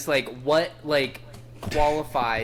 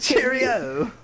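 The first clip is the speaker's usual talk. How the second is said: put-on British accent; 9 semitones higher, 4 dB louder than usual. British banter continues.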